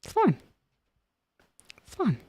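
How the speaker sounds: high strained then sinking; forced fine. Pretend. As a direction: insist it's fine through gritted teeth. Strained fake calm.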